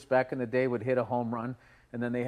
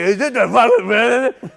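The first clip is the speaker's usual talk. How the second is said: mock lady; 6 semitones higher, 10 dB louder than usual. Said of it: "has to be like".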